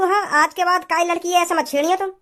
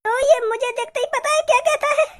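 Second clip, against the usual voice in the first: ordinary talk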